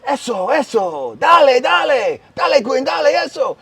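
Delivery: loud, high Spanish cheering; sideline cheering. Retold or imitated. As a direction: urge the kids on at full voice. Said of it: "they'll tell the kids".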